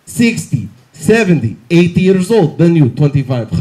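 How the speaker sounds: put-on comic Russian doctor accent; blunt list in a put-on Russian accent. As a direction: count off like a mock Russian doctor. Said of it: "the weird Russian doctor I had".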